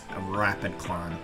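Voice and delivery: ordinary talk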